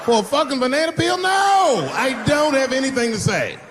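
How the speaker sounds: slowed indignant yell; indignant shout. Retold or imitated. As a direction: shout it down, outraged. Retorting to the press about banana.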